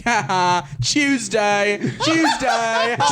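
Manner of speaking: high, laughing mockery; mocking laugh. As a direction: sneer it out through laughter. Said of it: "to being like".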